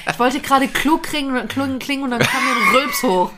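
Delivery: laughing at herself, embarrassed. Real, not acted. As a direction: laugh through the words. Embarrassed after burping.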